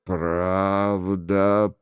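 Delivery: low, slow, muted pronouncement; a solemn accuser, deep and dull; hollow voice. A narrator's reading. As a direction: intone it low and drawn out. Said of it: "произнёс глухо голос".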